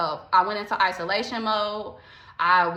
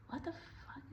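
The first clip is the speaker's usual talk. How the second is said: stunned whisper; whispered disbelief; 5 semitones higher, 24 dB quieter than usual. Retold or imitated. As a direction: breathe it out under your breath, baffled. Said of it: "looking at her like".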